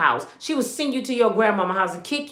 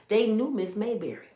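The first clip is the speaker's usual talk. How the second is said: usual speaking voice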